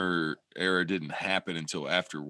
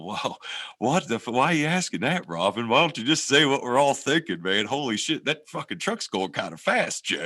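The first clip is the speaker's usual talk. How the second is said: mock retort; 6 semitones higher than usual. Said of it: "sitting there drinking my coffee going".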